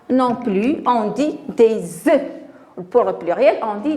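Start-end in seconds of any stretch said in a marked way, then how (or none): 1.5-2.2 slow "des œufs" ending on eu; silent F in plural. Shown as a demonstration. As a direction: link with z, drop F in œufs. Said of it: "Le F sera". (des œufs, liaison et F muet)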